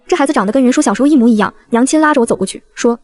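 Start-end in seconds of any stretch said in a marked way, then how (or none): none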